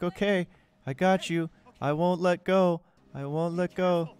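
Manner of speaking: drawn-out mock-tender pledge; mock-heroic reassurance. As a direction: vow it with hammed-up earnestness. Acted reassurance, Titanic parody.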